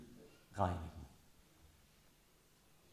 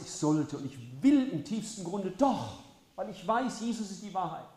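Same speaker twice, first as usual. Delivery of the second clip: impassioned preaching; 5 semitones higher, 5 dB louder than usual. Acted. Preacher speaking Jesus' promise to sinners, impassioned build. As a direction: pour out each I will.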